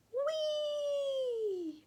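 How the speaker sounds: high, long joyful squeal; whee. Acted. Acorn squealing while tumbling through the air.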